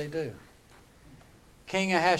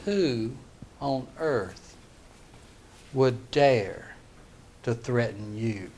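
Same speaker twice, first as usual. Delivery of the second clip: word by word, with heavy pauses; measured indignation; 5 semitones lower, 3 dB quieter, slower than usual. Acted. King answering Esther's plea, slow, measured indignation. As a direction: let each phrase land separately, lower toward the end.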